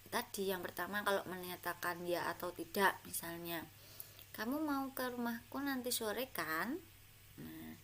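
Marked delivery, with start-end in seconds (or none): 4.3-6.8 tune rising at the end on kan; rising question. Shown as a demonstration. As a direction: lift the voice up on the final kan. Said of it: "itu kan naik".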